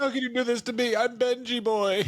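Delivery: high-pitched mock pleading; pleading husband act. Acted thrown-out husband pleading.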